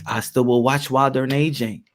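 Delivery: ordinary talk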